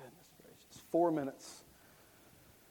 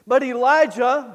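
ordinary talk

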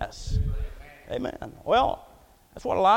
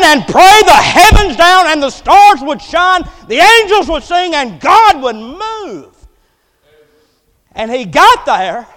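louder, rolling preacher's build to a climax; full-force preaching; 7 semitones higher, 12 dB louder than usual. Real, not acted. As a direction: preach it up loud, piling phrase on phrase.

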